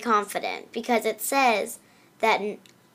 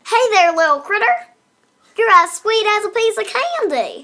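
sweet Southern lady; 10 semitones higher, 9 dB louder than usual. Acted. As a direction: coo at a little critter. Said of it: "I really believe that one".

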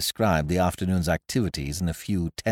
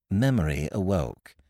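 ordinary delivery

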